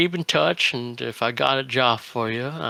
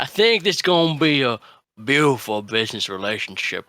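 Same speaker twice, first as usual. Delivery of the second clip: normal talking voice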